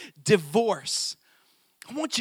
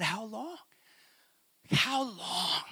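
lament; 7 dB quieter, slower than usual. Retold or imitated. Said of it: "Jesus cried over Israel".

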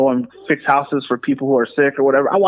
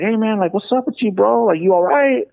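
higher, concerned friend voice, mildly imitated; imitating Anthony; 8 semitones higher than usual. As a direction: voice your friend checking on you, pitched up. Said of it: "Anthony was like".

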